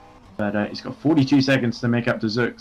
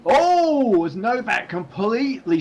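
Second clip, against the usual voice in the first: sharp high yelp of surprise; surprised; 11 semitones higher, slower than usual. Real, not acted. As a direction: caught off guard, jumps up high. Sudden crash on track.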